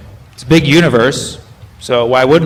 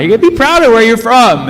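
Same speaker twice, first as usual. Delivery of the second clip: a bit higher, louder insisting; retold insistence; 8 semitones higher, 4 dB louder than usual. Comic retelling his reply to Swedes, mock insistent. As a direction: urge them to be proud, lifted. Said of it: "I'm like".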